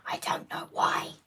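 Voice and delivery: hushed ASMR-style whisper. Real, not acted. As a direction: whisper to the listeners. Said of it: "We're whispering again".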